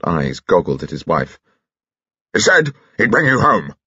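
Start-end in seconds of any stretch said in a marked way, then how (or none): 2.3-3.7 raised-pitch blustering general; angry accusation. A narrator's reading. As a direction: accuse your wife with outrage. General angrily questions his wife.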